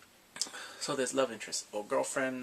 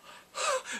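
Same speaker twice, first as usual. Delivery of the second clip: sharp gasp. Retold or imitated. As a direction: gasp as if you can't believe it. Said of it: "I was like".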